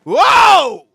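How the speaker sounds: a huge, high whoa; shocked shout. Real, not acted. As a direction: let it rip in disbelief. Reacting to a clip.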